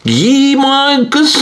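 high, slow, strange garbled voice; backwards speech. Shown as a demonstration. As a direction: sounds played in reverse. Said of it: "For example".

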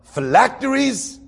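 high, slow, stretched-out question; imitating the man on the train. Stranger on the train asking about tefillin, high slow mock voice. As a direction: draw out phylacteries high and slow like a curious stranger. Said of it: "I kid you not".

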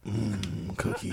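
low, soft, drawn-out rumble; omnipresent voice. Pretend. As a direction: drop deep and hum it like an all-around voice. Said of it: "like, an omnipresent voice".

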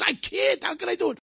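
high desperate pleading, voice cracking up; distressed boy. Retold or imitated. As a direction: play the panicked shy boy begging. Shy boy pleads to storyteller.

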